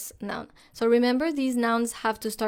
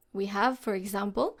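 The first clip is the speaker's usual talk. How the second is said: normal talking voice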